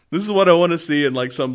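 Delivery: ordinary talk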